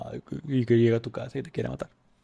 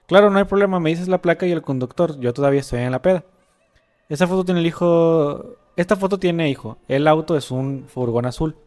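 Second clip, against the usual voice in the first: louder, voicing the son's texts; the son texting his dad from a disco, read out loudly; dramatic story reading; 6 dB louder than usual. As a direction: read the chat story aloud with energy. Reading chat story aloud.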